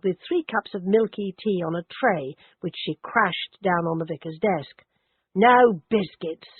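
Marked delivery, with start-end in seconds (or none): 5.3-6.5 louder, slow and surly; snarled. Acted. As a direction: spit it out with contempt. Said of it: "she snarled".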